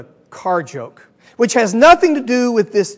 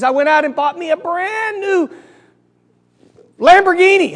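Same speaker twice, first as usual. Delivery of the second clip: high pitch, stretching the build-up; excited joke-character brag; boastful reveal; 8 semitones higher than usual. Acted. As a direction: hold off on the car's name, then announce it proudly. German reveals car in joke.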